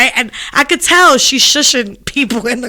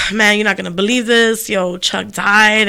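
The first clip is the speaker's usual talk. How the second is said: retold crying voice; 5 semitones lower, 4 dB quieter than usual. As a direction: break the news, heartbroken. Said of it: "she has the crying voice".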